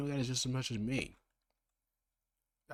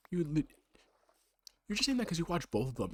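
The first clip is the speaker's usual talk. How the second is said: louder, higher indignant comeback; indignant retort; 8 semitones higher, 5 dB louder than usual. Acted. Other self objecting to his own claim, indignant. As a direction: snap back at your other self, offended.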